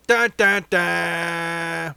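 loud "dun dun dun" suspense sting; dramatic sting. Pretend. Host teases the accent question, mock-dramatic sting. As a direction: voice a mock cliffhanger cue.

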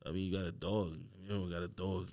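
low, soft Staten Island accent; understated Staten Island accent on "dog". Shown as a demonstration. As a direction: do a Staten Island guy, voice dropped. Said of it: "if you had a Staten Island accent".

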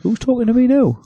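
high-pitched, playfully baffled question; mock bewilderment. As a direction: act confused by a voice from nowhere. Feigns confusion at hearing voices.

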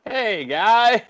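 high, loud put-on greeting; mock over-friendly greeter. Host mimicking people who slap you mid-story, over-friendly mock greeting. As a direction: imitate an overeager arm-slapper hailing someone.

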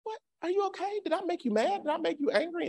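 tiny, very high anxious voice; mock a timid, over-worried squeak; worried pleading. Student asking if professor is upset, squeaky worry.

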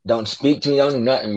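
ordinary talk

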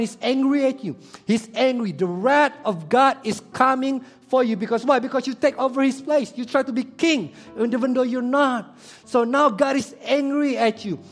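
high, loud, driving sermon delivery; full-force preaching. Acted. Pastor warning the congregation of God's wrath, loud preaching. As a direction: preach it hard to the crowd.

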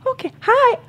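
high, startled "hi" from the mother; unfazed mom. Retold or imitated. Roommate's mother walking into the orgy, startled politeness. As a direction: take in the scene and squeak a polite hi. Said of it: "She says".

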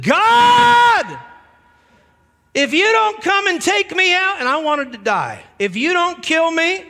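high, loud retold cry to God; loud retold plea to God, slow and anguished; desperate shout to God. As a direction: shout it like a man at the end of his rope. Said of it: "I shouted at the top of my lungs".

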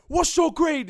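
loud, high shriek of a word; screaming teacher. Retold or imitated. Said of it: "He's screaming at him".

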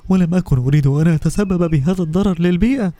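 a small bottle telling its hard story; first bottle. Acted. First bottle explaining to Lulu, mildly sad.

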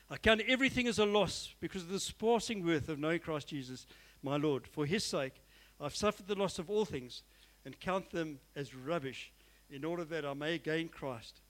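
solemn Bible reading; scripture reading. Acted. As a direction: read the verse with reverence. Reads scripture aloud.

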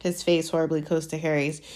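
usual speaking voice

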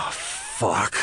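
weary sigh into a curse; sigh, then let the word drop out; acting out getting up with a groan; groaning morning curse. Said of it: "first word out of my mouth".

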